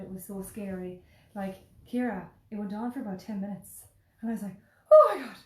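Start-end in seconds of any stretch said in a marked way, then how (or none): 4.9-5.4 very high, loud burst of alarm; re-enacted shriek of panic; panicked cry. Passenger reliving ten minutes of turbulence, panicked.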